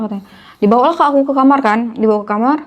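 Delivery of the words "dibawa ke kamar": ordinary delivery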